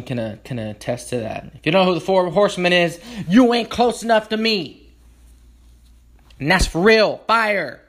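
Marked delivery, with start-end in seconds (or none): 1.5-7.8 excited shout. Real, not acted. Hypes up his friend group.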